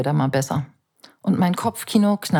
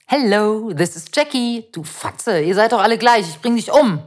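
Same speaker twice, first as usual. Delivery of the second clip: mock menacing rant, higher and louder; drunk neighbour ranting; 3 semitones higher, 3 dB louder than usual. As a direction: rage at the door like a troll. Neighbour raging at the door.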